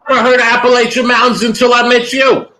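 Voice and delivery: high, rapid defensive outburst; loud protest. Real, not acted. Protesting in a heated playful argument.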